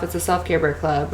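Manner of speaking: ordinary talk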